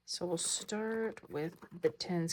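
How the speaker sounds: ordinary talk, nothing marked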